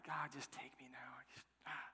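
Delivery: very soft, half-whispered exasperation; exasperated plea. Acted. Jonah begging God to take him, hushed and exasperated. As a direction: breathe it out as a worn-out prayer.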